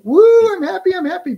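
high, loud hammed-up cheer; sarcastic woo of fake happiness; mock glee. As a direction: parody of forced happiness. Mocking people who only like joy.

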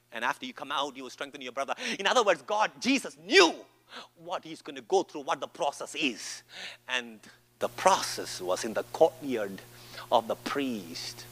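usual speaking voice

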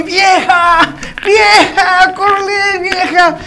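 high, drawn-out call for the wife. Acted. Husband calls his wife up the stairs, calling out.

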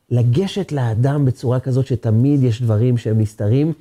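ordinary delivery

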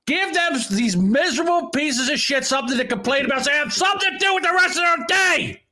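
rising into a shout; building shout. Real, not acted. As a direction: escalate into yelling.

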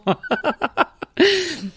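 high burst of laughter; real high laugh. Real, not acted. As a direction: laugh at your own joke. Laughs at her joke.

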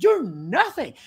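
loud, high, cutting retort; scornful put-down. Retold or imitated. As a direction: snap it at him with contempt. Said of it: "I said".

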